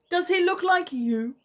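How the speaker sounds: drowsy and unhurried, like Pooh Bear; sleepy koala. A narrator's reading. Sleepy koala asking the worried emu, drowsily. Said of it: "She asked slowly".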